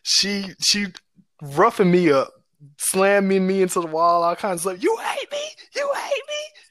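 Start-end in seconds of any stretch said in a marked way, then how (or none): 4.8-6.6 much higher shouted cry, hammed-up rage; high, crying mock yell; furious mother's shout. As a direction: scream it like an enraged mom. Mother furiously yelling while beating.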